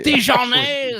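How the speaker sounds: very slow, high mock French; French chef. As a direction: stretch it out like a snooty chef. Mocking the French stereotype.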